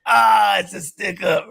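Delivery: loud, high playful robber yell; hammed-up holdup shout; mock stickup shout. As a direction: act out the gun bit, shouting. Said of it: "Hey, [name], do the gun".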